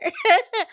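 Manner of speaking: quick high burst of laughter; real amused laugh; short laugh. Real, not acted. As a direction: laugh it off lightly. Laughing at own nervous aside.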